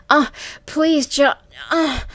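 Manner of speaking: high, loud, broken pleading; crying out. A narrator's reading. Woman trying to speak to Johnny, crying out. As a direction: plead between sharp cries. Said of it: "compelling me to cry out".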